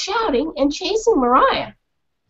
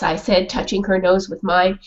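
plain reading voice

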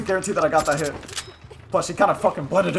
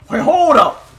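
loud, higher urgent call; alarmed shout; 7 semitones higher, 9 dB louder, much slower than usual. Real, not acted. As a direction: yell to halt everything. Alarm during play.

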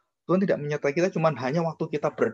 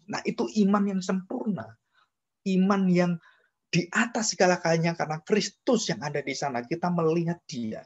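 high, ringing sermon voice that keeps climbing; preaching at full force; 5 semitones higher than usual. Acted. Pastor exhorting the congregation from the pulpit, preaching at full force. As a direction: drive each line home to the congregation.

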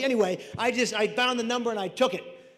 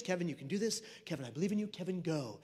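soft, lower self pep talk; quiet self-affirmation; 3 semitones lower, 8 dB quieter, faster than usual. Retold or imitated. Kevin psychs himself up for the lobster, softly affirming. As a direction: talk yourself into it under your breath. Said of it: "I say my positive affirmations".

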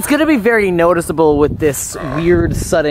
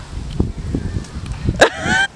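high laugh; 4 dB quieter than usual. Real, not acted. Laughs after the scene.

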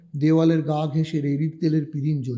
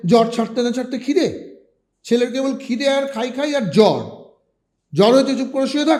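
higher, louder, fast scolding; scolding mother; 6 semitones higher, 4 dB louder than usual. A narrator's reading. Mother usually scolding her hungry son, loud and fast. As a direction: snap at the greedy boy.

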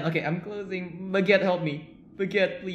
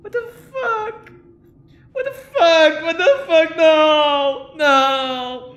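high, loud shrieking panic; terrified scream; 12 semitones higher, 13 dB louder, much slower than usual. Real, not acted. Streamer screams at a horror game scare, loud high shriek. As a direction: scream it out in real terror.

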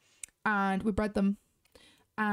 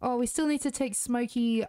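ordinary talk, nothing marked

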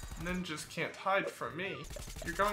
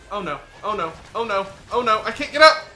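rising, louder panicked cries; building panic; 3 semitones higher, 12 dB louder than usual. Real, not acted. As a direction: let the panic climb to a yell. Panic building while trapped.

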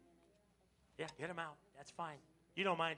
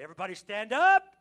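rousing call; 12 dB louder than usual. Real, not acted. Pastor calling the congregation to stand and praise, loud and rousing. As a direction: lift the whole room to its feet.

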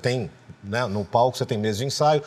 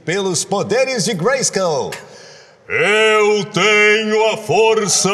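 slow, rising, much louder heroic roar; He-Man battle cry; 7 semitones higher, 6 dB louder, much slower than usual. Shown as a demonstration. He-Man's voice actor delivering the famous transformation cry. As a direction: build into the cry and belt out the force line. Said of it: "imitar alguma coisa do He-Man".

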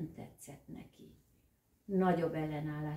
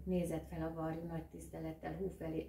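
ordinary delivery, nothing marked

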